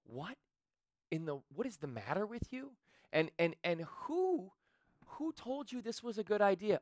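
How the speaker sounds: hushed, halting disbelief; lightly voiced wife, stammering in dismay; appalled wife. Imagined wife confronting husband over the car, stunned disbelief.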